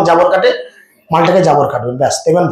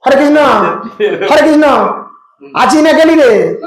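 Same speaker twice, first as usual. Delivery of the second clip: raised, calling down the phone; on the phone, calling out higher; 4 semitones higher than usual. Acted. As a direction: call out down the line to a friend. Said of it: "কাউকে হয়তো phone করলো".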